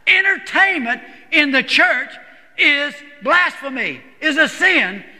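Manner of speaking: high-pitched, emphatic declaration. Retold or imitated. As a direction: drive each phrase home like a preacher. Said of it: "he was emphatic about it".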